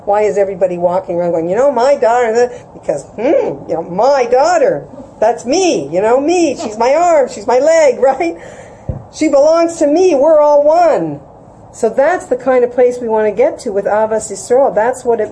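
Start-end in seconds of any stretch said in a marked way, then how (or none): 1.5-11.2 high, loud gushing parent bragging; hammed-up boastful parent, louder and higher; proud parent boast. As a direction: gush like a parent claiming the child's glory.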